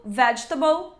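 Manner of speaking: vegetable squeezed to "VEDGE-tbl"; vegetable shortened, ending in a reduced "tbl"; swallowed letters. Shown as a demonstration. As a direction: say vegetable with the middle vowel dropped. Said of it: "de novo".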